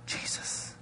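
very soft, voiceless whisper; hushed re-enacted whisper, mic off. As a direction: whisper it secretly into his ear. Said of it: "I whispered with the mic off".

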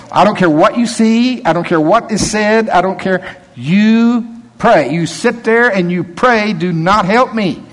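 slow and insistent, warn them off; stern warning. Retold or imitated. Michael warning the helpers not to interfere, stern and slow.

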